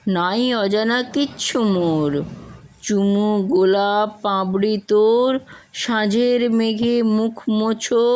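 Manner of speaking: measured, rhythmic poetry reading with a full voice; poem recitation. Acted. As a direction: recite it like a stage poem, savouring each rhyme. (ছন্দময় কবিতা আবৃত্তি)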